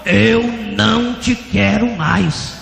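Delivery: loud, very slow proclamation; voice of God refusing. Retold or imitated. God refusing the begging pastor, loud slow rejection. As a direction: thunder each word out slowly.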